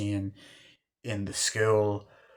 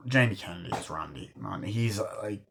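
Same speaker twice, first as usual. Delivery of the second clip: usual speaking voice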